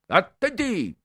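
high, loud snapped order; barked command. A narrator's reading. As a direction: bark it like a drill cadet. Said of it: "ringhiò il cadetto". (il cadetto ringhia l'ordine alle reclute, secco e forte)

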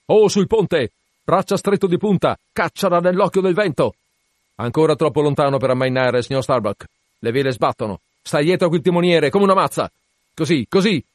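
high, loud orders from aloft; shouting down to deck. Acted. Captain at the masthead ordering the deck, loud shout. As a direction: bellow the commands down to the deck.

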